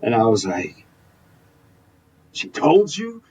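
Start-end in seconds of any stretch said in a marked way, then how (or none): 2.3-3.2 high, startled "She told you?"; caught-off-guard squeak of disbelief; stunned disbelief. Retold or imitated. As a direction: pitch jumps up in shocked surprise at being found out. Caught, learns who told on him.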